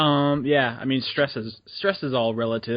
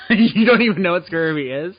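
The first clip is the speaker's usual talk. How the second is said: laughing disbelief; 7 semitones higher, 8 dB louder than usual. Real, not acted. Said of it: "incredulous laughing question".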